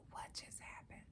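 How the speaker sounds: barely audible whispered question; stunned whisper. Retold or imitated. Woman alone after the call hung up, stunned whisper. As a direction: retell it as a stunned whisper to yourself. Stunned, talking to herself in retelling.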